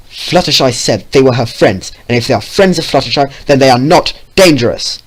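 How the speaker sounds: angry shouting. Acted. Vio still shouting at Green.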